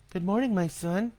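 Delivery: a mother greeting her son kindly; smiling mother. A narrator's reading. Mom greets her son in bed, smiling and sweet. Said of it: "she smiled".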